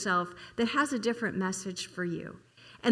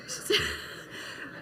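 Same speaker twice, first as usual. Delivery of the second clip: breathless laugh; 8 dB quieter than usual. Real, not acted. Laughing at her own naivety.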